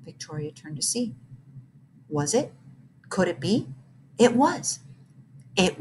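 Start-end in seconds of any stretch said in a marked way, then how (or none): none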